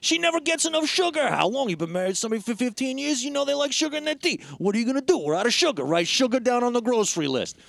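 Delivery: imitating a husband ranting about sugar; mock rant. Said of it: "he's, like, ranting".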